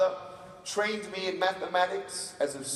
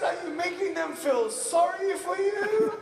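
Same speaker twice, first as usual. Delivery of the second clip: high-pitched mock accusation; suspicious mom; 12 semitones higher, 4 dB louder than usual. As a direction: question him like a suspicious mother. Mother interrogating her son.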